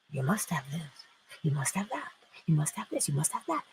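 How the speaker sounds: hushed, repeated urging under the breath; a soft nagging whisper; whispered insistence. Retold or imitated. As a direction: whisper it like a nagging voice in your head. Being told you must have.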